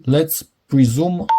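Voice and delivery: ordinary delivery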